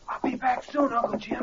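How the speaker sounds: soft, hoarse, half-whispered farewell; faked hoarse voice. Impostor saying goodbye to Uncle Jim, faked hoarse voice. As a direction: fake a lost voice while leaving. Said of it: "It's hard to talk hoarse like that".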